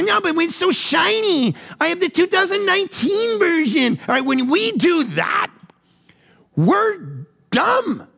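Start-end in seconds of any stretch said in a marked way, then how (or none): 0.0-4.0 high, whiny pleading for the car; a child clinging to a toy; whiny materialist. Retold or imitated. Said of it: "We go".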